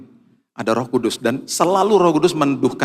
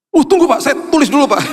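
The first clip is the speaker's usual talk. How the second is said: much higher, louder eager voice; eager young man; 13 semitones higher, 5 dB louder, faster than usual. Retold or imitated. The young driver eagerly asking the preacher to wait. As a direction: hurry to write the name down, excited. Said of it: "the man eager to note his name".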